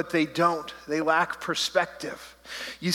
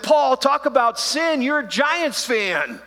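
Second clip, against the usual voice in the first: much higher, louder and slow teasing scold; mock rebuke; 9 semitones higher, 5 dB louder, slower than usual. Pastor roasting a Giants fan in church: slow, higher, louder mock scold. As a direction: drag it out to roast Paul. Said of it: "I looked over and said".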